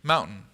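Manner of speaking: Mountain with the T swallowed into a glottal stop. Shown as a demonstration. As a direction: drop the T in Mountain, catch in the throat. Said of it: "What do we do?".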